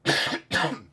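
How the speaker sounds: loud, real throat-clearing cough; throat-tickle cough. Real, not acted. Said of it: "A little tickle in the throat".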